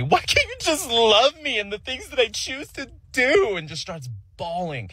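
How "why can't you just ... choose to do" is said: much higher, whiny imitation of the girlfriend yelling; mock yelling girlfriend. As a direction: play the upset girlfriend in a high, put-on voice. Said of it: "immediately starts yelling at me".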